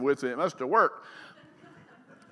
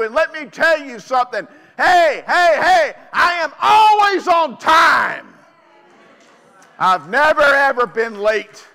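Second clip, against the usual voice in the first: voice of God; 4 semitones higher, 6 dB louder than usual. Retold or imitated. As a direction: proclaim as the Lord himself. Preacher voices God speaking.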